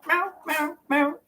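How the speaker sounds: high little mock meows; high-pitched kitty impression; imitating cat meow. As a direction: mimic a cat that keeps complaining. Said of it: "she just sits there".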